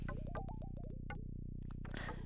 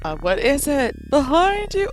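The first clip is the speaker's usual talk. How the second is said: slow, wary and higher; uneasy character voice; 7 semitones higher than usual. Acted. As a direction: ask it nervously. Reads character line.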